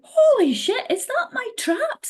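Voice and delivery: high, drawn-out amazed exclamation; stunned, high-pitched retelling; astonished. Retold or imitated. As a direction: gasp it out like you can't believe your eyes. Recalls astonished reaction to own photo.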